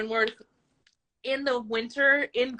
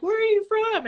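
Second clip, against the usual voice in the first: very high, drawn-up question; imitated nosy locals; 12 semitones higher than usual. Southern locals quizzing a traveler, in a high imitation. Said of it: "everybody's like".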